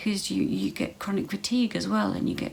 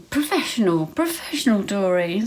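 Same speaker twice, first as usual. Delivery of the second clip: louder, higher self-mocking outburst; self-mocking exclamation; 3 semitones higher, 6 dB louder than usual. Real, not acted. Vlogger mocking her own blunder, louder and higher. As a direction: tease yourself loudly for fumbling.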